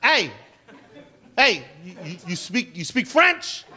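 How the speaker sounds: mock cop calling out, rising on French; cop's voice. Cop calling out to the comedian, mock questioning. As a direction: hail him like a pushy cop. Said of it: "he's like".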